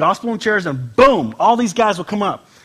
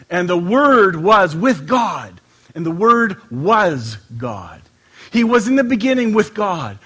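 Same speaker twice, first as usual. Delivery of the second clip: slow, louder solemn reading; scripture reading; 5 dB louder, much slower than usual. Acted. Pastor reading John to the church, slow solemn scripture. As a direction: read it out like holy writ, slowly.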